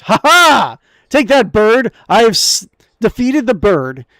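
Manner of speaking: loud, high mock-heroic gloating; triumphant hero. Player's hero gloats over the bird, loud and high. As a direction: crow over a beaten bird.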